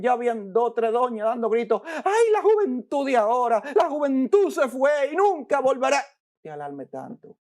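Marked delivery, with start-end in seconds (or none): 1.8-6.0 higher, mock wailing; mock an old lady mourning lost youth; preacher imitating ladies crying out; lamenting old lady. 6.0-7.4 much softer, lower reassurance; whisper-soft calm; preacher gently hushing the lamenting lady; hushed calming. Pretend.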